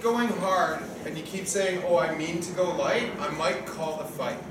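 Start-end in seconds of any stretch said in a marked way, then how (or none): none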